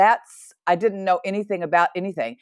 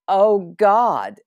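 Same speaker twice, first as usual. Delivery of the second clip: a louder, higher plea; retold cry to God; 4 semitones higher, 5 dB louder than usual. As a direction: call out to God in alarm, remembered. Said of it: "I remember crying out".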